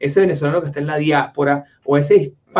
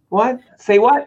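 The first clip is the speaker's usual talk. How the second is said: higher-pitched, puzzled mock American reply; uncomprehending American; 6 semitones higher, 3 dB louder, slower than usual. American failing to understand a British accent, acted bafflement. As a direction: react baffled, as if the accent is lost on you. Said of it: "Y no, no te entienden".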